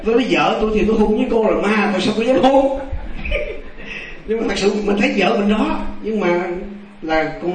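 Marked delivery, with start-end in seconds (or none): 0.3-2.8 high-pitched refusal ending in laughter; retold protest, pitch climbing toward a laugh; amused protest. Husband refuses to kiss a ghost, high laughing protest. 2.8-4.2 quiet, amused chuckle; soft laugh. Real, not acted. Storyteller laughs after refusing the kiss, soft chuckle.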